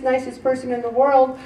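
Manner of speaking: ordinary talk, nothing marked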